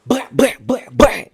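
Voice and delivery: high, sharp mouthed blows; punchy vocal hit effects in a quick row; imitated punch noises. Storyteller acts out hitting the man, imitated punch noises.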